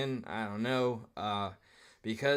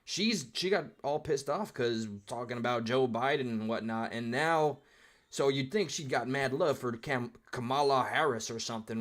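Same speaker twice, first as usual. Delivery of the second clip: ordinary talk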